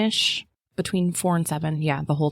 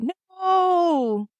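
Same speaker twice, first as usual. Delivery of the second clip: mock scream; 14 semitones higher than usual. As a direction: imitate a horrified mother-in-law's no. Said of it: "our mother-in-law screamed out".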